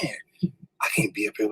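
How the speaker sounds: soft, low, doubting whisper; intimidated whisper. Retold or imitated. Nervous applicant whispering self-doubt beside polished rivals. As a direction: murmur it like you want to leave.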